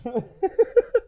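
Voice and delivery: high burst of laughing; high, giggly laugh; laughter. Real, not acted. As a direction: crack up at your own bit. Laughing at own bit.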